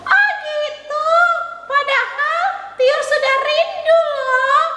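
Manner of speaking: high, cheery puppet voice; chirpy child puppet saying hello; puppet girl Tiur. Acted. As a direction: greet the kids as the little puppet.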